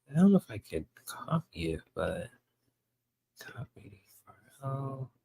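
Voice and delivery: under his breath while fiddling with a link; a private aside, barely voiced; whispered to himself. Real, not acted. Streamer muttering to himself while copying the video link, whispered.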